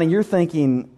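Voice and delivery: ordinary delivery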